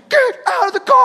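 very high shouted police order; commanding shout. Retold or imitated. Retold cop interrupting an arguing driver, commanding shout. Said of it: "he was commanding".

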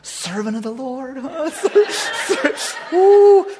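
high-pitched mimicry of self-congratulation; mocking Lord. The Lord ribbing the proud servant, mock-impressed. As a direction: tease with put-on, swooning admiration. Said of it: "And the Lord's like".

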